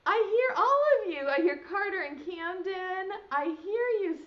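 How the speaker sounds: high and loud, bursting with delight; excited praise. Real, not acted. Teacher thrilled to hear the whole class answer. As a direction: cheer them on, big and bright.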